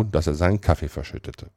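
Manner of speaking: plain reading voice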